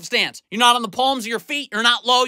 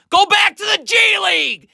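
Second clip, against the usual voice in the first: high, loud dismissive yell; angry shout; 9 semitones higher, 4 dB louder than usual. Real, not acted. Coach yelling at Gabe Vincent after a turnover, angry shout. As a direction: tell him off at full voice.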